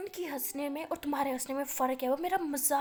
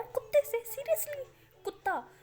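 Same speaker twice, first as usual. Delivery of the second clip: outraged disbelief; 11 semitones higher, slower than usual. Acted. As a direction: shriek it in wounded disbelief. Uncle outraged at dog comparison.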